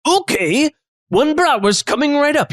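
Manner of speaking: high-pitched cook taking an order; chef character. Acted. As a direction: call the order back, high and hammy.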